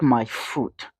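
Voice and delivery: scornful aside. Real, not acted. Scoffing at presidential escort excuse.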